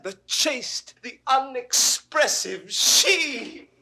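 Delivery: high, loud, soaring ecstasy; exultant lovestruck cry; rapturous declaration. Acted. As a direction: proclaim your love loudly, building to a long-held last word. Lover rapturously praising beloved.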